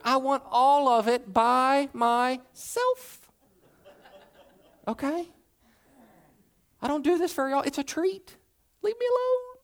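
high-pitched, comically defensive whine; hammed-up, squeaky protest; mock possessive pleading. Mock possessive plea over ginger ale.

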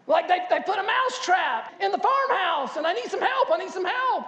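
high, loud, frantic mouse voice; panicked mouse. Retold or imitated. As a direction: rush the plea to the pig in a high panic. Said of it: "And so he said".

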